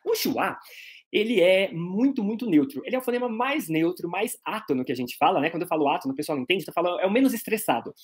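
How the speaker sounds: ordinary talk, nothing marked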